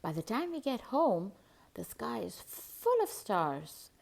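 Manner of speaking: usual speaking voice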